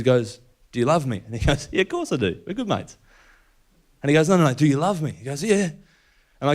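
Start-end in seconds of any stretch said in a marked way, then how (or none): 1.7-3.0 higher, chummy and offhand; breezy Peter. Retold or imitated. Peter brushing off Jesus, higher and breezy. 4.3-5.1 louder, higher, pressing the question; insistent Jesus. Retold or imitated. Jesus pressing Peter a second time, louder and firmer.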